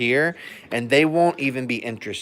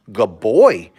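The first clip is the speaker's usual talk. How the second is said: louder, firm praise to the dog; praise demo; 3 semitones lower, 9 dB louder than usual. Shown as a demonstration. Owner showing viewers how to praise a dog, louder. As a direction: say it clearly and loudly, like praising your dog. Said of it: "you're gonna say".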